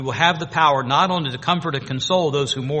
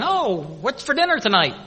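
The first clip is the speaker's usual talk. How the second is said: lightly voiced carefree people, higher; mock cheerful; 6 semitones higher than usual. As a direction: mimic happy chit-chat, pitched up. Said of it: "There won't be cheerful, happy people saying".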